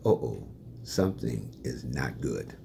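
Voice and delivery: quiet, low dread; hushed uh-oh. Retold or imitated. Seeing his mother shake her head, a quiet low uh-oh. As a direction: murmur it like trouble is coming.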